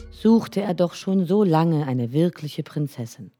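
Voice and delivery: plain reading voice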